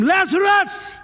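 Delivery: a high, loud commanding call by name. Acted. Preacher voicing Jesus calling Lazarus from the tomb, loud call. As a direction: summon him out of the tomb. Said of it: "Oh, and he didn't whisper it".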